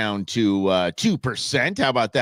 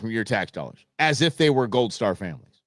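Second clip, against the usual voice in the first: normal talking voice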